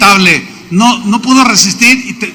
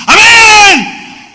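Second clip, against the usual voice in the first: high, loud, long amen; imitating the loud brother yelling back; shouted amen; 13 semitones higher, 6 dB louder than usual. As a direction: belt it out like an overeager worshipper. Congregation member yells amen.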